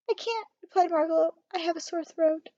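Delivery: a hint of a hoarse little bird; lightly voiced little bird. A narrator's reading. Bird with sore throat.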